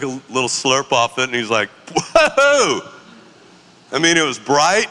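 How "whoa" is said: high, loud startled whoa in an odd voice; jolted by a bitter sip; shocked whoa. Retold or imitated. Brother tasting strong espresso for the first time, startled yelp.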